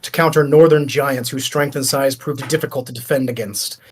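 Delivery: game master reading out the lore. Acted. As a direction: read the item's history to the party like a codex entry. Game master reads item lore.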